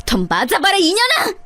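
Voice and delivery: furious yell. Acted. Narrator beating the sister-in-law.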